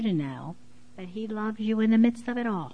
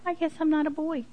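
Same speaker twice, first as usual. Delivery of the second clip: put-on giving-in little boy; 8 semitones higher, faster than usual. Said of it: "And he says".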